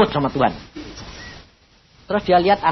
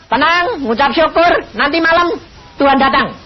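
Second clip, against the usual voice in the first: calling on the phone; 9 semitones higher, 4 dB louder than usual. Retold or imitated. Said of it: "Saya tuh terus telepon".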